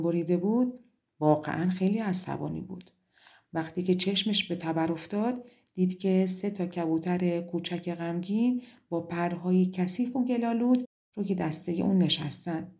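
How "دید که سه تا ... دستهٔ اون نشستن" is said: usual speaking voice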